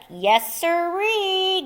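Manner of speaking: ordinary delivery, nothing marked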